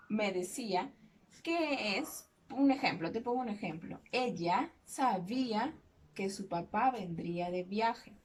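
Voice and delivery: normal talking voice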